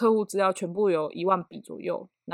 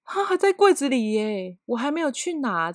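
imitating flustered coworker; 5 semitones higher than usual. 轻轻模仿同事慌张慢慢说. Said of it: "他就一脸惊讶".

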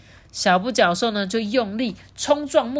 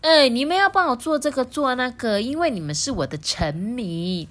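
bossy princess; 6 semitones higher than usual. A narrator's reading. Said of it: "顶嘴公主有时候也会说".

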